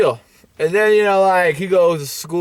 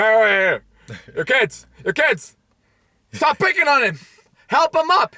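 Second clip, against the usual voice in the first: lightly acted teacher calling out, rising higher; 6 semitones higher than usual. Teacher telling kids off in gym class, high mock scolding. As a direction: call out to break up a scuffle. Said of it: "the P E teacher's like".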